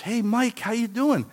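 friendly hey-Mike greeting; cheery greeting. Retold or imitated. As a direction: call out hi like a glad old friend. Old friend greeting him cheerfully.

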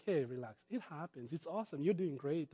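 reassuring. Retold or imitated. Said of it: "I'm like".

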